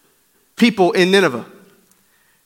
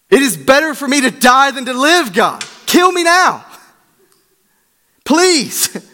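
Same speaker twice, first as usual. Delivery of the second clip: despairing Jonah; 7 semitones higher than usual. Acted. Preacher voicing Jonah begging God to take his life. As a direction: cry out to God begging for death.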